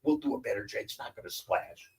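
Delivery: conspiratorial hush; hushed scheming. Retold or imitated. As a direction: keep it low so parents won't hear. Kids scheming quietly.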